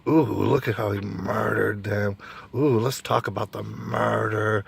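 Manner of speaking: a breathy-free, lingering mock ghoulish host; creepy crime-show narrator. Vlogger mocking true-crime TV hosts, lingering and creepy. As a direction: savour the murder like a creepy narrator. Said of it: "I swear, this is what it is".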